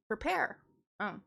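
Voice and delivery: ordinary delivery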